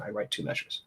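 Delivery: whispered, drained afterthought; the composer shrugs off the two measures in a whisper; whispered aside. Retold or imitated. Barely voiced resignation.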